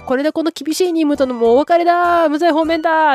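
retold celebration. Gang celebrating the end of their mission, retold cheer. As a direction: imitate a relieved crew cheering, endings stretched. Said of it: "ギャング団が祝っていた".

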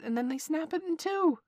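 jumps high, mimicking the monkeys; squeaky mock of the chattering monkeys; mocking monkey squeak. Kaa mocks monkeys snapping branches.

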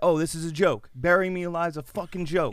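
high, loud, furious retort; furious outburst. Retold or imitated. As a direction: spit it back in outrage. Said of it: "And he's like".

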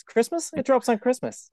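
high, rising "Christmas?"; shocked high rise on "Christmas?"; surprised question. Real, not acted. Doubting the date.

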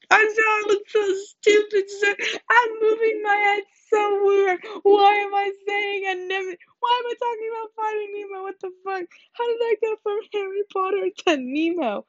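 hammed-up meltdown on one sustained high pitch; mock wailing breakdown. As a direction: wail it in a squeaky fake cry. Mock breakdown over her own rambling.

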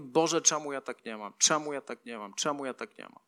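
low, soft self-pitying complaint, fading away; muttered lament. Acted. Acts out envious lament at home.

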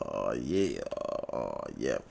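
very low mock oh yeah; deep low voice. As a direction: drop it way down, playful. Handy dandy notebook bit.

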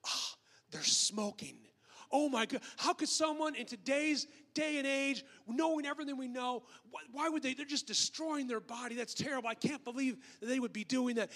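mock moral outrage at someone else's habit; railing in horror. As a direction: act appalled, building from a gasp into a high-pitched rant. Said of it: "just rail on something as being horrible".

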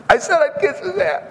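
high-pitched comic aside; mock squeal. Preacher admits his schoolyard surrender to the congregation, mock squeal.

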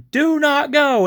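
emphatic acted-out warning; mock urgent command. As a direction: imitate desperately halting the troops. Retold urgent plea to stop troops.

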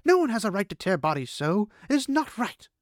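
high, loud angry outburst; furious at the wounds she is healing. A narrator's reading. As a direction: let the anger spill over. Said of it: "Is she angry".

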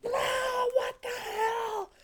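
high, slow mock shriek of alarm; waking up screaming. Son imitating mom yanked out of bed, startled high scream. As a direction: act out being jolted awake screaming. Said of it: "immediately just wakes up screaming".

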